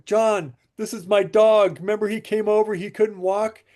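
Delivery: high-pitched mock crying, without real sobs; imitated tearful call. Client phoning about his dog walking, mock tearful. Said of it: "crying on the phone".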